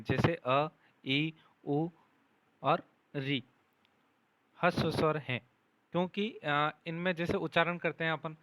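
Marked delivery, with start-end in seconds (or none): 0.0-3.4 अ, ई, उ, री each voiced short and clear; clipped vowel sounds अ ई उ री as a model; short vowels demonstrated. Shown as a demonstration. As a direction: sound out अ, ई, उ, री one by one for pupils. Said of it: "उन्हें ह्रस्व स्वर कहते हैं".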